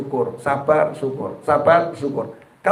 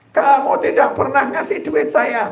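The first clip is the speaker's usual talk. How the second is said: higher, slower complaint in a wife's voice; mock nagging wife; 7 semitones higher, slower than usual. Wife complaining to her husband about money, mock nag. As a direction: play the wife accusing him, pitched up and drawn out.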